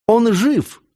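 high, sudden exclamation; excited cry. A narrator's reading. Christine seeing the wounded man open his eyes, high excited cry. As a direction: cry out as the pitch leaps up. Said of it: "вскричала Кристина".

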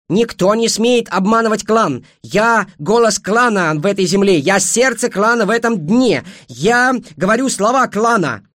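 high, loud, slow and fierce declaration; ringing proclamation. A narrator's reading. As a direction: proclaim it like a clan's ritual curse. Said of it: "звенящим голосом сказала Эйджел". (звенящим голосом, громко, торжественно и грозно)

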